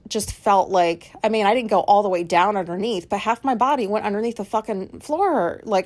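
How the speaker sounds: ordinary talk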